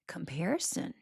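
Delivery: whispered, with a rise at the end. Real, not acted. Vlogger hushes the dreaded word for effect, whispered. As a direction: breathe the word comparison like a secret. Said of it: "whispered dramatic word".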